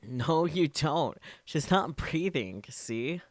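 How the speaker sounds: loud, high and slow angry words; slow furious shout. Acted. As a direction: drag each word out in rage. Daru angrily rebuts Okabe.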